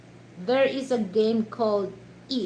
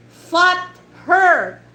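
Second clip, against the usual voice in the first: each word loud and high; dad sounding out a word; 8 semitones higher, 12 dB louder than usual. Retold or imitated. Retelling a dad misreading combined word, loudly sounded out. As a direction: punch out two separate loud words. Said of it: "he said".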